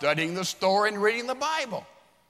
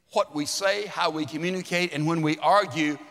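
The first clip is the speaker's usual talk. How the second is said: ordinary talk, nothing marked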